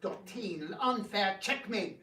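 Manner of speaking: ordinary talk, nothing marked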